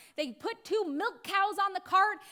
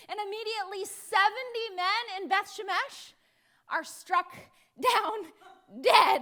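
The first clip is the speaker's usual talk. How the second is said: normal talking voice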